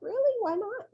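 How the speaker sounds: high, surprised question; mock surprise. Teacher asking her students why no salad, high and mock-surprised. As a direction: play it up as startled. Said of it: "I was like".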